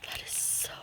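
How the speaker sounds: very soft whispered wonder with little gasps; hushed, breath-taken delight; awed whisper. Real, not acted. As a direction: whisper in admiration, barely voiced. Awed by the paint colors.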